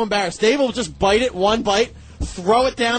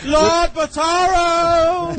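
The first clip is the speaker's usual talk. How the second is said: slow, drawn-out showman call; grand announcer; 6 semitones higher, 3 dB louder, much slower than usual. Acted. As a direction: announce him like a ring announcer. Grand introduction of the guest.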